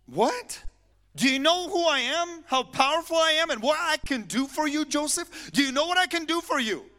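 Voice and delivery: loud, high-pitched incredulous boasting; indignant outburst. Acted. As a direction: exclaim it like someone powerful who's been refused. Acting out Joseph's offended tempter.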